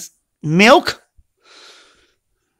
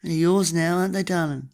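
ordinary delivery

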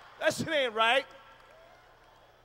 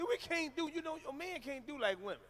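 usual speaking voice

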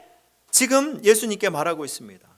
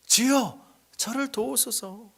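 fading, much softer plea; 2 semitones higher, 7 dB quieter, slower than usual. Retold or imitated. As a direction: say it again almost under the breath. Plea repeated softly. (낮게 읊조리는 간구)

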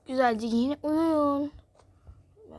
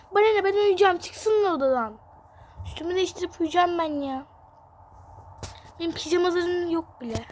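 put-on higher, louder sulky angry girl; 5 semitones higher, 4 dB louder than usual. Older daughter snapping at mother about her siblings, angry girl. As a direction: snap back like a cross teenager. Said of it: "Bir sinirli kız arkadaşlar".

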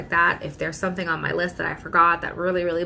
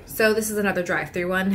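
usual speaking voice

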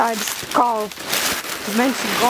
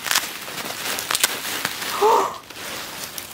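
long voiceless gasp of wonder; amazed gasp; 8 semitones higher, 4 dB quieter than usual. Real, not acted. Looking at pots made specially, amazed gasp. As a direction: draw in a breath, astonished.

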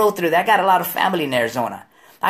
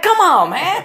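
exasperated plea; 12 semitones higher, 4 dB louder, slower than usual. Retold or imitated. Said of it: "I'm like".

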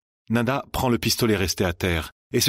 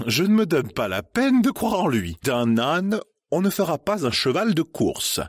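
higher, pinched society lady; prim disdain; 6 semitones higher than usual. Acted. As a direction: sniff at the new generation. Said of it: "d'un air pincé".

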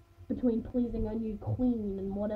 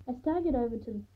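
usual speaking voice

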